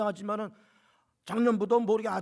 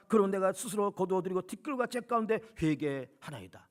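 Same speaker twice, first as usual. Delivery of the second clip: scripture reading; 3 semitones lower, 3 dB quieter than usual. Shown as a demonstration. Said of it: "한번 들어보세요".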